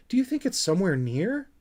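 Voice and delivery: high, fast and nervous; anxious. Acted. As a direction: rush it, getting anxious. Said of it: "beginning to get anxious".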